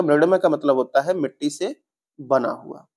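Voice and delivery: usual speaking voice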